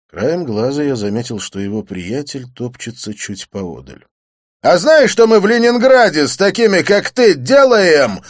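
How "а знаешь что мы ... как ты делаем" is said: high, loud menacing bark; barked threat. A narrator's reading. The Leningrad thug threatens Didier, barking. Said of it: "рявкнул блондин".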